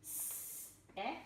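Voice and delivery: whispered "S-e" with soft s; sounding out. Shown as a demonstration. Teacher sounding out s-e for the class, whispered. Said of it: "it's gentle Cindy".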